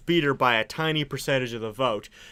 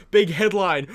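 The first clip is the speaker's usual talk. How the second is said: ordinary delivery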